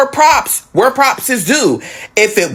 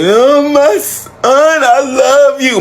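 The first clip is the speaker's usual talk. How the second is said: slow, higher mock sob of love; mock crying mother; 4 semitones higher, much slower than usual. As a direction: drag it out like a teary mom. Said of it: "she start crying".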